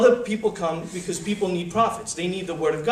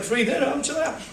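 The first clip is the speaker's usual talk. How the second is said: casual guest voice; 8 semitones higher than usual. Retold or imitated. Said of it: "they're like".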